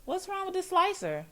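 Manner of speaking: high-pitched, softened mock politeness; mock coy manager. Manager hinting about the dirty slicer, high mock-coy voice. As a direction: play it high and falsely innocent.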